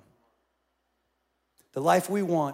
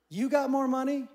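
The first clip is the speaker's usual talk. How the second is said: put-on kid asking; 8 semitones higher, 4 dB louder than usual. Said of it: "And he goes".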